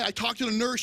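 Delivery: normal talking voice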